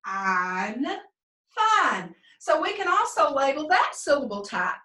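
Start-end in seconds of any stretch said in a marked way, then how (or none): 0.1-2.2 teacher blending "fine" with a long i; long vowel blend. Shown as a demonstration. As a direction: sound out "I'm", pause, blend into "fine". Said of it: "Let's do it again".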